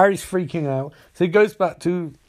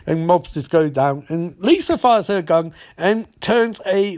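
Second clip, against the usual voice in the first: ordinary talk, nothing marked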